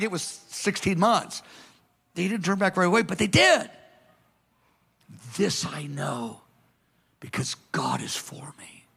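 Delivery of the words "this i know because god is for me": "This I know because God is for me" said softly, almost a whisper; hushed confession. Acted. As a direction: drop to a near whisper for the confession. Quiet confession of faith.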